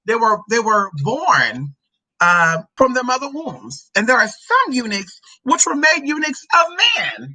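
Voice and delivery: slow, rising Bible recitation; scripture reading. Acted. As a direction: proclaim it with drawn-out emphasis. Reads scripture aloud.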